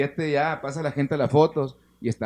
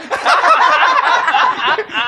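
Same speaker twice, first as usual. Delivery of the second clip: loud burst of laughter; 14 semitones higher, 16 dB louder than usual. Real, not acted. Punchline of the Pancho story.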